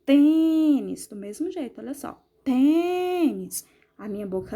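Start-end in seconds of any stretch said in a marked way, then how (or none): 0.0-1.1 "Tênis" with a loud, drawn-out TÊ; stressed closed syllable. Shown as a demonstration. Teacher demonstrates the closed sound, stressed TÊ in "Tênis". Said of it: "ela fica mais fechada". 2.4-3.7 "Tênis" with a higher, held TÊ; stressed closed syllable. Shown as a demonstration. Teacher repeats for the pupils, long stressed TÊ in "Tênis". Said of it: "ela fica mais fechada".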